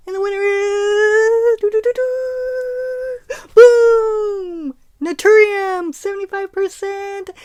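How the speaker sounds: high, loud drumroll winner reveal; hyped giveaway announcement. Real, not acted. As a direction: build up then burst out the winner.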